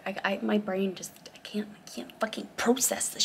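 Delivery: flustered frantic whisper; hushed, overwhelmed whisper. Real, not acted. Unvoiced whisper heard.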